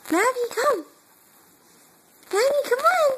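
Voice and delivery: high sing-song calling; coaxing calls. Real, not acted. Mother calling her baby to crawl over, high and coaxing.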